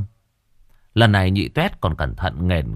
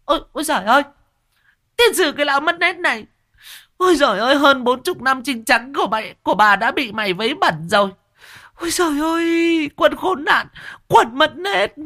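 very high, wailing village woman cursing; hammed-up furious auntie lament; much higher screeching market-woman rant; scolding shrill woman. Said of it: "mà mắng".

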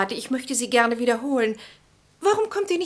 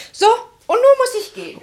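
much higher and louder, theatrical; loud announcement; 11 dB louder, faster than usual. Acted. Julia announces her departure to the party, loud and high. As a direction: announce the exit to the room.

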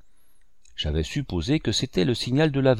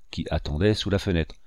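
ordinary delivery, nothing marked

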